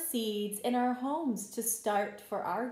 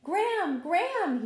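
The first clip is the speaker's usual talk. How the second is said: higher, louder call across the garden; 8 semitones higher, 7 dB louder than usual. Acted. Joe calls Graham to see sprouts, excited calling out. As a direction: call her name excitedly. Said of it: "he shouted".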